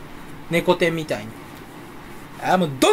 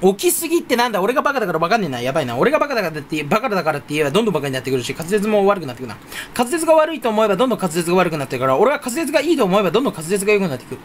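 breathless run-on rattle; Tommy-like rapid talk; faster than usual. Real, not acted. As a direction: tumble through the words without pausing. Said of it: "すごいトミーさんの喋り方みたいになっちゃう". (早口でまくし立てる)

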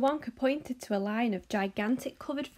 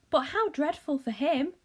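cried out; 6 semitones higher, 4 dB louder than usual. Acted. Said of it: "cried Charlie".

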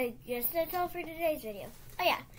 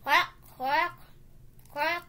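louder duck quacking; Donald Duck voice; 6 dB louder, much slower than usual. Retold or imitated. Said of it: "That was my Donald Duck".

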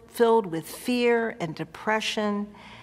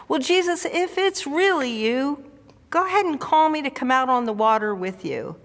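eager Peter; 5 semitones higher, faster than usual. Acted. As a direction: dare him, half thrilled and half scared. Peter shouts to Jesus.

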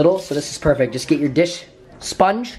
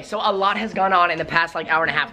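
ordinary talk

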